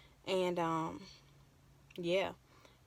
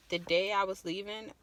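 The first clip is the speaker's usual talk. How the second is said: ordinary talk, nothing marked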